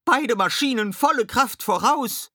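high, loud command; urgent high-pitched shout; shouted counter-order. A narrator's reading. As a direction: overrule the skipper at full voice.

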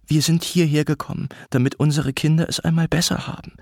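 mother's energetic, contained blame; suppressed arguing. A narrator's reading. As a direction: build to a pointed accusation. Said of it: "energisch, jedoch mit unterdrückten Stimmen".

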